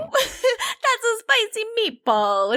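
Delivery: slow, high cartoon Italian through laughter; laughing, over-the-top Italian impersonation; mock Italian accent. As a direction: crack up while hamming up Italian. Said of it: "just have an Italian accent".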